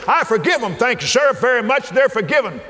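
eager recruit speaking up to God; mock soldier salute. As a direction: play a private asking permission.

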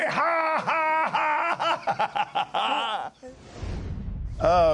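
high delighted laughing burst; real laughter. Real, not acted. Preacher laughing with joy after the devil story, high laughter.

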